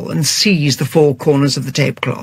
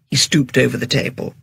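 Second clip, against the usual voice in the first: ordinary delivery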